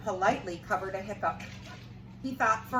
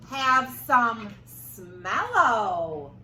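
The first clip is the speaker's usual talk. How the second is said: very slow, stretched monster request; slow drawled request; 5 dB louder, much slower than usual. Acted. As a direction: stretch every word out. Hungry thing drawls request.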